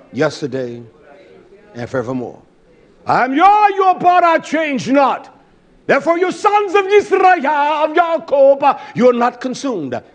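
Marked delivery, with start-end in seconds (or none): 3.0-10.0 loud high preaching shout; preacher roaring God's promise to Israel; shouted proclamation. Real, not acted. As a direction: thunder the scripture to the crowd. Preacher proclaims scripture at full force.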